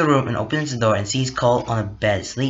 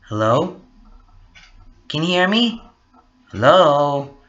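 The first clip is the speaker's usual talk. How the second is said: hellos building louder down the line; insistent phone call; 3 semitones higher, 4 dB louder than usual. Acted. Cole tries one more time, insistent. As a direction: press harder for any answer.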